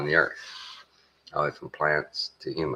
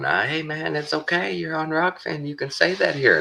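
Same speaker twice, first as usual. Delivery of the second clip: much higher, easy-going mock voice of a carefree streamer; laughing Rockfin guy; 13 semitones higher than usual. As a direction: imitate a relaxed guy brushing off worry. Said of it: "they're laughing, going".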